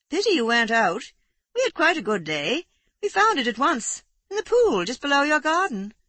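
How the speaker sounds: hearty country neighbour back from the hunt; Aurora hearty. A narrator's reading. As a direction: chat cheerfully about the kill. Narrator voices hearty neighbour Aurora.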